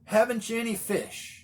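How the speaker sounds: high, loud call across the water; cheery call from a distance. Retold or imitated. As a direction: hail the boat from the shore. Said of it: "he calls out to them".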